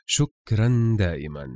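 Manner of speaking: usual speaking voice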